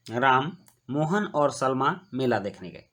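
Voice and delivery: Ram held briefly apart from Mohan और Salma; pause after Ram. Shown as a demonstration. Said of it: "यहाँ राम के बाद अल्प विराम दिया गया है". (राम के बाद अल्प विराम देकर)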